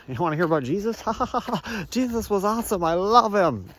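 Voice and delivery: high, bright-pitched possessed voice, laughing; God speaking through him. Retold or imitated. Vlogger voicing God taking over his mouth, high and laughing. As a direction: let the other voice take over, high and giddy. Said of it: "they'll just control my voice".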